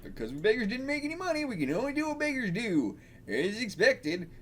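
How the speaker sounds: put-on high-pitched poor country-bumpkin accent. Beggar explaining what beggars do, country bumpkin accent. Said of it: "kinda like country bumpkin accents".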